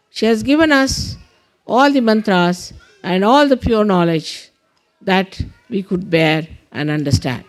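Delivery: deliberate prayer leader with long pauses; measured meditation instructions with space to follow; guided meditation. Real, not acted. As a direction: guide them through it, phrase by phrase.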